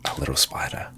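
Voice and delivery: whispered fond aside; soft whisper. Real, not acted. Streamer whispering about the little dog, fond. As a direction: breathe it tenderly.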